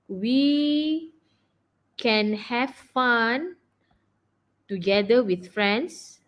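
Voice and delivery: very slow, with long gaps between phrases; drawn-out words, piece by piece; dictating while writing. Real, not acted. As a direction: say it as you write it out. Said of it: "I'm gonna write down here for you".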